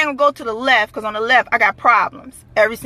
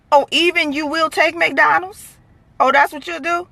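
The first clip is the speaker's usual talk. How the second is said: mock disbelief; 6 semitones higher than usual. Mock disbelief at beggar's choosiness.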